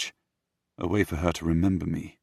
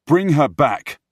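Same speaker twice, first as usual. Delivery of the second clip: loud, high, sharp order; commanding; 9 semitones higher, 11 dB louder, slower than usual. Acted. Prince ordering his men to fetch Olivia, commanding. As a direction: demand it forcefully.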